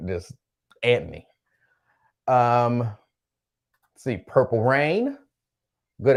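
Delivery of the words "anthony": low-pitched "Anthony" with no H; aunts' pronunciation. Retold or imitated. Host showing how aunts said it, low "Anthony" without H. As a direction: ignore the H, say "Anthony" as "Antny". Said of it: "They would just ignore the H altogether".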